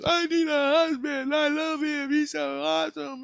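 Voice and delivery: very high squealed imitation of her; mimicking her hysterical shriek in a thin falsetto; mock scream. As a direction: squeal it high without full volume. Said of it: "She just screamed right in his face".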